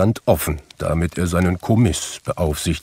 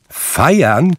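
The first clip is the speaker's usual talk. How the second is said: much higher, louder rising question; incredulous repeat; 8 semitones higher, 5 dB louder than usual. A narrator's reading. Nephew echoing his uncle, incredulous. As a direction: echo the word in disbelief. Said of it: "wiederholte Scrooges Neffe".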